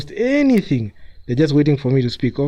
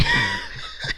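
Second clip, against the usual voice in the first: laugh; 7 dB quieter than usual. Real, not acted. Laughing at lopsided score.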